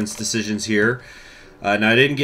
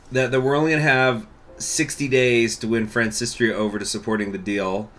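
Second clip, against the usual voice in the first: ordinary talk, nothing marked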